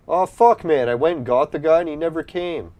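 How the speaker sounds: genuinely annoyed, high-pitched complaint; real frustration. Real, not acted. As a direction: burst out in exasperation, pitch jumping up. Player he fetched never came.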